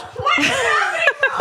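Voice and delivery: high shrieking burst of laughter. Real, not acted. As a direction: let out a high squealing laugh. Friends mock his photo.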